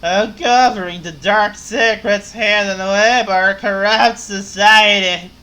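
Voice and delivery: loud, slurring tipsy journalist; ranting like she's had a few; drunk voice. Acted. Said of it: "to make the drunk voice".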